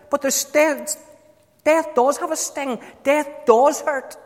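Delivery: high, fervent preacher cry; impassioned preaching. Real, not acted. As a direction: plead it from the pulpit.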